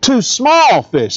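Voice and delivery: "small" drawn out very slowly and leaned on; slow, weighty "small" in the middle of the phrase; stress on small. Shown as a demonstration. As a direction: drag out "small" to show how tiny they are. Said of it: "It doesn't just say fish".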